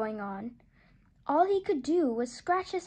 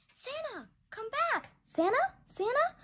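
much higher, calling after someone; 10 semitones higher than usual. Acted. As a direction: call him back across the distance. Calling after departing Santa.

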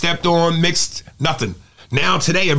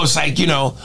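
ordinary talk

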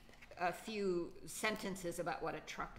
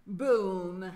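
loud booming crash; booming crash word; 12 dB louder than usual. Shown as a demonstration. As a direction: boom it out big. Acting out crash noises.

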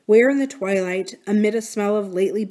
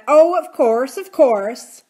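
mimicking Flora; 5 semitones higher, 8 dB louder, slower than usual. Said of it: "She said".

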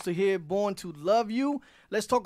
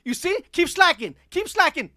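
imitating Gail scolding; 8 semitones higher than usual. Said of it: "She said".

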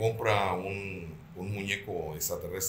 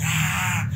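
retold scream, higher and louder; 10 semitones higher, 7 dB louder than usual. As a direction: let out the wife's sudden scream of fright. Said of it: "grita y dice". (grito de susto de la esposa, más agudo y fuerte)

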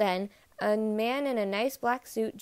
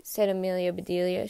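ordinary delivery

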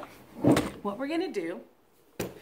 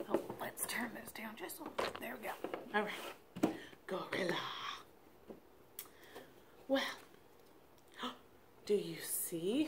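whispering; 3 semitones lower, 14 dB quieter, much faster than usual. Real, not acted. Whispers, turning sound down.

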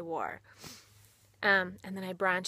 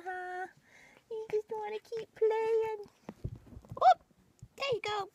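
put-on baby talk to pets; 11 semitones higher than usual. Owner greeting her rabbits in a high cooing voice. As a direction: coo up high at the bunnies.

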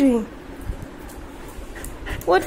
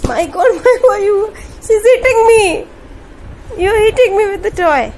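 louder and higher, laughing while complaining; laughing protest; 4 semitones higher, 5 dB louder than usual. Real, not acted. Parent laughing as the baby whacks them with a toy, protesting loudly. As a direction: cry out half amused at being hit.

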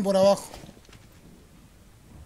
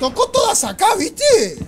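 much higher, louder delight; excited; 10 semitones higher, 9 dB louder, slower than usual. Real, not acted. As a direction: shout it out thrilled. Excited about trap hits.

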